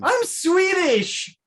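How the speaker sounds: slow, high put-on Swedish accent. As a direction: drawl it out in a fake Swedish accent. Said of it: "listen to my accent".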